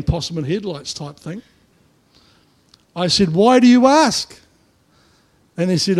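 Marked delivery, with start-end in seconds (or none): 3.3-4.4 higher, louder, slow and careful; wary reply. Retold or imitated. Pastor answering the loudmouth, slow and raised. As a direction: stall for time, slowly. Cautious reply before ninety tradies.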